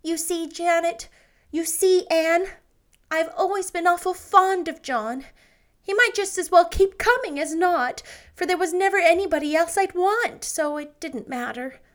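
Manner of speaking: a hint of a meek older woman confiding; Janet, timid spinster. A narrator's reading. Janet's voice in the story.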